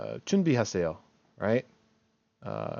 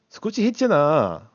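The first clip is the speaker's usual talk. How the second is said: "했잖아" pushed higher and louder, fast; emphatic protest; 5 semitones higher, 7 dB louder, much faster than usual. Shown as a demonstration. Teacher gives Korean version of I did do it, emphatic. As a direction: rattle it off insistently, leaning on "했잖아". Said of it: "give that emphasis there".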